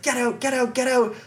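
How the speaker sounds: high, insistent repeated command without much volume; mock yell of a guard shooing someone off; retold angry order. Security guard ejects the fan, retold angry command.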